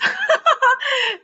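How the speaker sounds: high burst of laughter. Real, not acted. Guest laughing about quitting law twice. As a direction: laugh out at herself.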